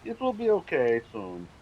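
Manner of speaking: hammy broken-English Russian comrade; terrible Russian accent. Pretend. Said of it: "in terrible Russian accent".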